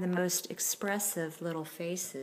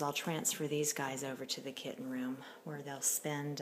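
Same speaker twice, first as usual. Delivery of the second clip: usual speaking voice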